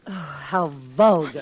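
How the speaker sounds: prim disgust; snobbish disdain. Acted. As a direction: look down on him. Snobbish nurse.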